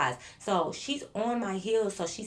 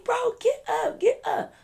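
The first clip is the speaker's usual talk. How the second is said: very high, squeaky urging; mock urgent pleading; 5 dB louder than usual. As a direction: imitate an exasperated friend in a high voice. Friend urging fallen teller up.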